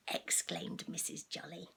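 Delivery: usual speaking voice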